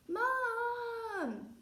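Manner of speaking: loud high scream for mum; child screaming for his mother. Acted. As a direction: shriek out for mum. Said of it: "screamed Peter".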